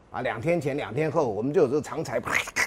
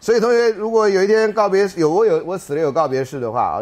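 loud address; 5 semitones higher, 8 dB louder than usual. Real, not acted. Addresses class with loud joke.

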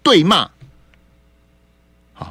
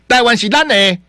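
a lightly voiced emotional slogan, a touch higher; quoting Lai Ching-te; 6 semitones higher, 4 dB louder than usual. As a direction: mimic the vice president saying it, with mild feeling. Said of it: "赖清德说啊".

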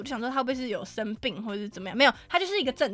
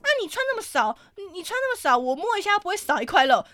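high, indignant retort; mock the groper's shameless excuse, pitched up; indignant old man; 8 semitones higher, 3 dB louder than usual. The old man snapping back at her, high indignant. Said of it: "就他也恼羞".

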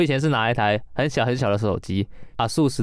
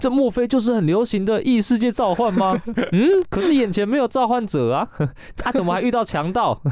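higher, animated 这莫非 with stress on 莫; hammed-up startled hero thinking aloud; anime character inner voice; 9 semitones higher than usual. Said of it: "就是他说".

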